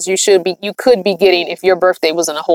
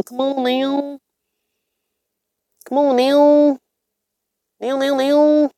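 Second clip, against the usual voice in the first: talking through a yawn, words stretched and higher; 4 semitones higher, 5 dB quieter, slower than usual. Real, not acted. Tired streamer fighting a yawn on a live, yawning. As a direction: try to talk while a real yawn takes over. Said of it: "I'm sorry that I'm yawning".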